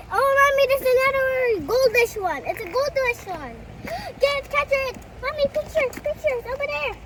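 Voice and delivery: high, loud, eager calling; excited. Real, not acted. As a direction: urge mommy on, thrilled. Child thrilled by golden fish.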